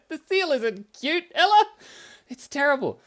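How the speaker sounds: very high, loud outburst; loud protest. Real, not acted. Protesting to Ella about the seal drawing, loud. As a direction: shout it high in comic dismay.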